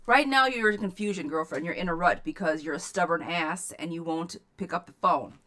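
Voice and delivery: loud, blunt scolding; firm, loud tough love. Real, not acted. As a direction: tell her off, loud. Tough-love reading to client.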